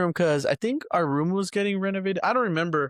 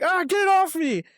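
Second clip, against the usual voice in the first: panicked scream; 13 semitones higher than usual. Retold or imitated. Kid with a cockroach on him, high panicked yell. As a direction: shriek to get the bug off. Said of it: "I was like".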